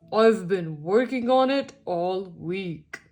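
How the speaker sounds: a hint of grumpy toad; lightly voiced grumpy toad. A narrator's reading. Grumpy Todd voiced in picture story.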